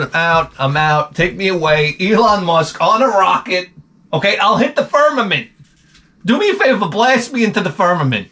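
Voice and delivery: exasperation climbing into a high-pitched plea; mock exasperation. Acted exasperated reply to son's slang.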